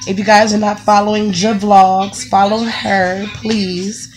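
usual speaking voice

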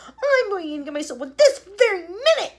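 high, loud bratty threat; defiant yell. Acted. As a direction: announce it loudly. Veruca charging in.

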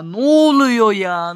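exasperated shout. Real, not acted. Frustrated at the game.

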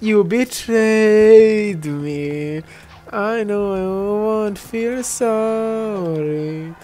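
very slow, drawn-out hammed-up hurt; playfully melodramatic accusation at a teammate; mock betrayal. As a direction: drag the words out like a soap-opera victim. Mock dramatic betrayal in game.

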